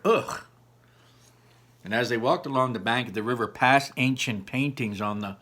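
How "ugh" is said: a higher, louder shuddering ugh; disgusted shiver. Acted. Ian shuddering at the horrid unicorns, disgusted. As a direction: recoil at the thought. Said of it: "said Ian with a shiver".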